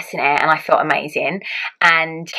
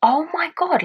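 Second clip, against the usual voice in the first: amazed; 10 semitones higher, slower than usual. Retold or imitated. New mother realising she has given birth, amazed. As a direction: voice lifted in disbelief.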